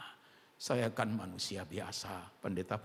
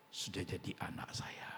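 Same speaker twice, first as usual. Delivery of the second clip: whispered reply; 2 semitones lower, 6 dB quieter than usual. Retold or imitated. Pastor's quiet reply.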